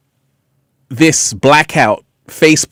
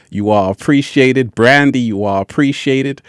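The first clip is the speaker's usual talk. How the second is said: normal talking voice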